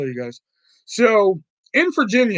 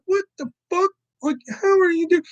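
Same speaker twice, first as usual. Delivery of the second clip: high, disbelieving what the fuck; incredulous; 10 semitones higher than usual. Retold or imitated. Kid baffled as mom solves the puzzle, high disbelief. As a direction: gasp it out in total disbelief. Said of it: "And I'm like".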